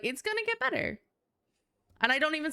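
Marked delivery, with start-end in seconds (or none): 0.0-1.0 much higher, firm declaration; high firm promise of better days; confident optimism. Retold or imitated. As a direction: state it like fact, brightly high. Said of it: "I will be like".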